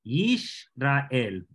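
Israel broken up as Yish-ra-el, with sh; Israel en hebreo. Shown as a demonstration. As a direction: sound out Israel slowly as Yish, ra, el. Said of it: "Fíjense cómo está aquí". (Israel separado en Yish-ra-el, con sh)

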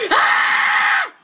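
short, very high scream; high yell of frustration; frustrated scream. Real, not acted. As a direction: shriek at losing. Screams at dying in game.

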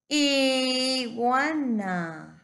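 IGUANA stretched very slowly and loud, opening I held long; drawn-out demonstration. Shown as a demonstration. Teacher helping a child find the I, IGUANA drawn out. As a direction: draw out every sound of IGUANA, loud. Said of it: "En qué posición está la I".

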